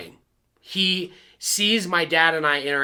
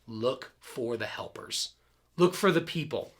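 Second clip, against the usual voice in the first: gentle advice; 3 semitones lower, 4 dB quieter than usual. Retold or imitated. Quoting a mother's gentle advice.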